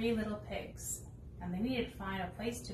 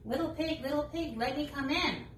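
higher coaxing wolf; sing-song sly wolf call; 5 semitones higher than usual. Acted. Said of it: "said the wolf".

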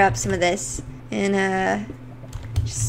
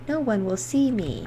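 normal talking voice

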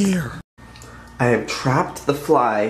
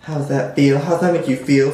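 ordinary talk, nothing marked

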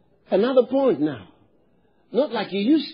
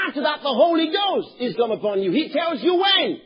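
preaching at full force; 4 semitones higher than usual. Real, not acted. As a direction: proclaim the verse like a trumpet. Preacher quoting scripture at full force.

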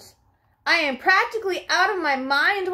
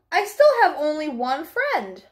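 ordinary delivery, nothing marked

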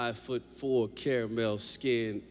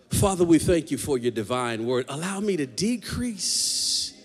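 prayer; 4 semitones lower than usual. Acted. Opening prayer.

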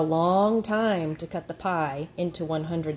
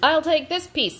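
high, eager gator claim; smiley gator; 8 semitones higher, 4 dB louder than usual. A narrator's reading. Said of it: "said the smiley gator".

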